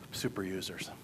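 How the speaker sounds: a low, breathy unvoiced whisper; whispered punchline. Real, not acted. Speaker telling the crowd about fans, whispered. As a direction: let the last words drop to a whisper.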